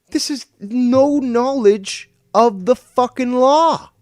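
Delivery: drawn-out outraged scream; screaming rage. Real, not acted. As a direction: scream each word out slowly. Repeats it even harder.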